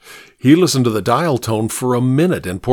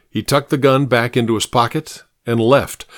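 ordinary delivery

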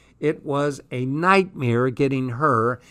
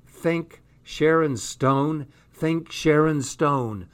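relieved; 3 semitones higher, slower than usual. Acted. Relieved inner prayer.

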